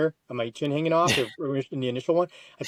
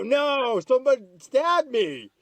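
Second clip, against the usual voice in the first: high indignant; 9 semitones higher, slower than usual. Retold or imitated. Telling girlfriend he was stabbed.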